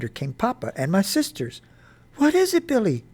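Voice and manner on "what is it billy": high-pitched anxious question; scared voice. A narrator's reading. Mother reaching her son on the hillside, scared. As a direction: ask it worriedly, pitched up but short of a shout. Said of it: "Mama asked in a scared voice".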